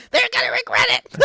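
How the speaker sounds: giddy high pixie squeal; gleeful shriek. Acted. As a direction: squeal it with mischievous delight. Pixie breaks into shrill glee.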